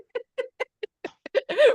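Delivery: a long high burst of laughter; high delighted laugh. Real, not acted. Laughs after plunger joke.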